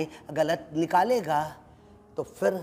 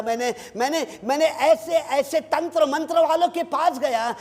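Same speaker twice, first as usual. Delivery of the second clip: raised, ringing sermon voice; full-force preaching; 7 semitones higher, 3 dB louder than usual. Acted. Pastor urging the congregation, preaching at full force. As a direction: preach it out to the whole church.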